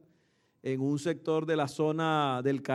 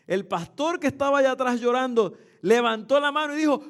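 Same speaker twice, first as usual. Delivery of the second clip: normal talking voice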